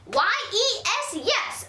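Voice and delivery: defiant. Acted. Girl defying her mother by spelling yes, high and defiant. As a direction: stamp back each letter. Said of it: "I stamped my foot".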